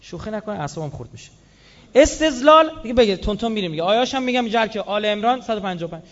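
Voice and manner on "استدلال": loud emphatic. Shown as a demonstration. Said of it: "word said again, louder".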